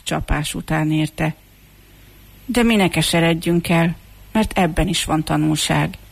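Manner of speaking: ordinary delivery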